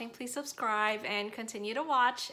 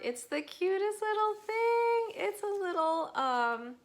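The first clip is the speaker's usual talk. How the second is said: excited gushing; 11 semitones higher, 5 dB louder than usual. Real, not acted. Gushing over a cute bookmark.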